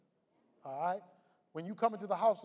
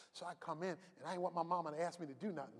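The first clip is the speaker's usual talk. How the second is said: ordinary talk, nothing marked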